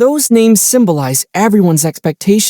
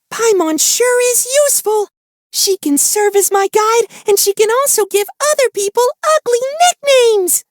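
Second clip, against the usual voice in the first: much higher squeaky mock little-companion voice; mocking Paimon. Traveler teases Paimon by imitating her, in a squeaky high mocking voice. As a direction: tease her by aping her cutesy voice.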